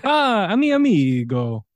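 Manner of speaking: mock Italian accent on Spanish words; fake Italian accent. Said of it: "with an obnoxious fake Italian accent".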